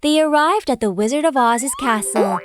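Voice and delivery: plain reading voice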